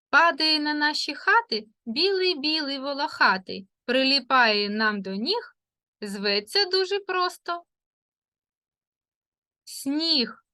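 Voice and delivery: lilting verse with a held pause before the answer; riddle poem. Acted. Teacher reciting a snow riddle to children, lilting with a pause. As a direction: recite the riddle, hold back the last word.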